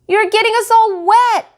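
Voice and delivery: put-on very high, loud complaining child; upset kids crying out. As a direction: wail about getting soaked. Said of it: "cried Skipper and Skeezer".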